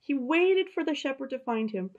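put-on reading to children. A narrator's reading. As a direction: tell it like a bedtime story to kids. Reads the story to children.

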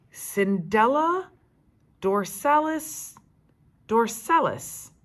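slow reading. Acted. Reading Latin name slowly.

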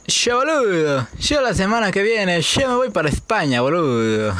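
acento argentino exagerado y lento; Argentine accent. Shown as a demonstration. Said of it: "No, ese es el argentino".

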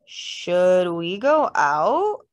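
drawn out, very slow and uncertain; hesitant question. A narrator's reading. Anxious question as fight goes on.

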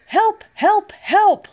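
high, loud calls for help; crying for help. Acted. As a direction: call out high and urgent. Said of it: "cried the little blue truck".

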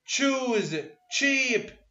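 تشوز and تشيب said with a sharp ch at the start; ch sound demo. Shown as a demonstration. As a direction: drill تشوز then تشيب clearly. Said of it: "وين ما أقرأ ش أقول". (نطق تشوز وتشيب بصوت التش)

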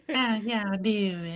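high-pitched character voice. Retold or imitated. Friend imitating how the character's voice changed, high and slow. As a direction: put on a high, slow Oklahoma twang. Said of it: "now she's much higher pitch".

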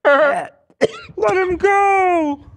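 extremely high, slowly stretched squeal; very high squeak. Pretend. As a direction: hold a tiny squeal. Continuing the high bit.

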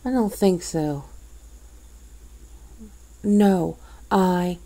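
ordinary talk, nothing marked

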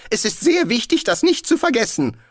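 high, loud, nervous insistence; nervös. A narrator's reading. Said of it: "unterbrach ihn Rincewind nervös". (nervös und eindringlich, hohe Stimme)

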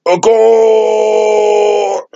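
loud, high cheer for a goal; excited cheer. Pretend. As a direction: shout it like a fan celebrating. Cheering at the cricket.

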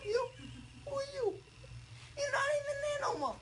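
high, fast squawky challenge; high-pitched challenge. Real, not acted. Dazed patient to partner, high-pitched challenge. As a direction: fire it off high and confused. Challenging listener, told not to yell.

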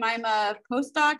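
usual speaking voice

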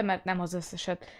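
ordinary delivery

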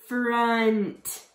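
"Front" drawn out, sounds stuck together; slowly blended word. Shown as a demonstration. Teacher blending sounds for kids, "Front" stretched slowly. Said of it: "you're going to stick it together".

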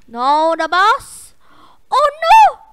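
high panicked shout; panicked yell. Real, not acted. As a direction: scream it in alarm. Boss appears suddenly.

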